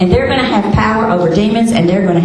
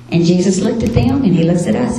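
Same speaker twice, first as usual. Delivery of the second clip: ordinary delivery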